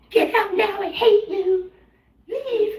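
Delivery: high, loud, shrieking get out; screaming breakup. Retold or imitated. Girlfriend throwing out a freeloading boyfriend, shrieking high and loud. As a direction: screech it like a fed-up girlfriend mid-fight. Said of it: "And that's usually a scene".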